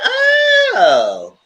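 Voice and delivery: high, loud outburst of mock sympathy; mock exclamation. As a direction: cry out oh it's not easy for you, way up high and loud. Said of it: "I said".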